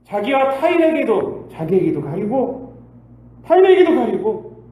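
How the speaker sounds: climbing sermon climax; building to a cry. Real, not acted. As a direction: preach it up to a peak.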